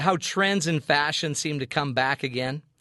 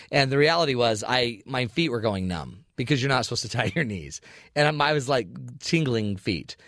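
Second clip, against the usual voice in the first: ordinary talk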